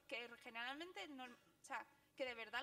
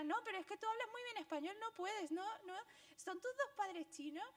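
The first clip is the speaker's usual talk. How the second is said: higher, quicker, incredulous questioning; amazed stranger; 8 semitones higher, 3 dB louder than usual. Retold or imitated. A stranger quizzing her about her Chinese parents, incredulous. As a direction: sound baffled that she speaks so well.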